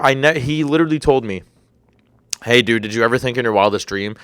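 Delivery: normal talking voice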